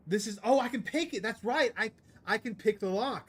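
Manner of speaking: loud, high burst of delight; excited realization. Real, not acted. Streamer realising the lock can be picked, excited.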